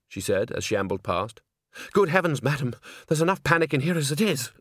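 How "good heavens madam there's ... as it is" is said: high, flustered lady voice; a fussed, high-pitched theatre lady; fussy lady. Acted. Fainting lady voiced by narrator.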